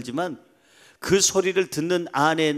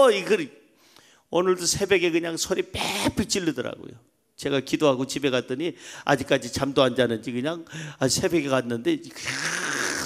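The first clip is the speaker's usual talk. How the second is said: usual speaking voice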